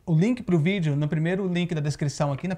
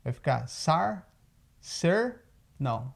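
ordinary delivery

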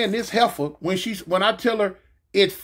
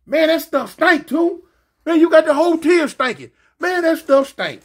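higher-pitched imitation of a complaining friend; raised, protesting retold voice; homie complaining; 7 semitones higher, 5 dB louder than usual. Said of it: "he was telling me".

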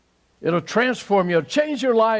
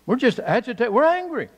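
higher, edgy mock anger; mock irritation, raised pitch; mock agitated; 9 semitones higher than usual. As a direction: act out an agitated people.

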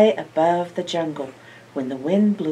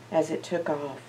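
plain reading voice

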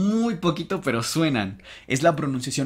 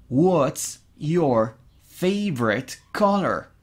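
What's your favorite color? spoken slowly, every word apart; slow model English; much slower than usual. Shown as a demonstration. Teacher slowly modelling the English question. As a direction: drill it slowly for the class. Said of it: "digo what's".

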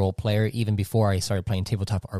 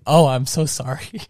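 higher-pitched flustered apology; apologetic; 7 semitones higher, 3 dB louder than usual. Retold or imitated. Game master apologising to players for mixed-up voices, apologetic. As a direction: plead forgetfulness in a raised voice.

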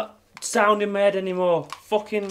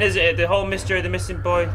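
usual speaking voice

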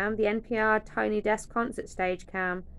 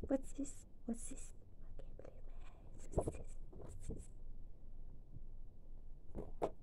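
hushed puzzlement; 6 semitones higher, 14 dB quieter than usual. Real, not acted. Puzzled hushed over item.

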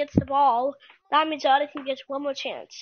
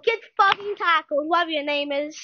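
usual speaking voice

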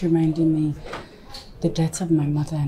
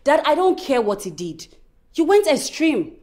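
raised voice at father; 6 semitones higher than usual. Acted. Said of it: "you are raising your voice at me".

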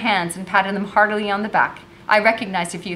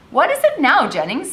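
raised, louder officer's question; impatient captain; 7 semitones higher, 5 dB louder than usual. A narrator's reading. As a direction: hit the question high and hard, then drop. Captain testily answers waiting soldier.

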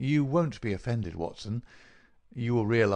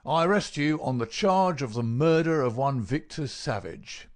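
higher, forceful official arrest; inspector making an arrest; 5 semitones higher, 4 dB louder than usual. Acted. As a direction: pronounce the charge with police authority. Inspector formally arrests the prisoner.

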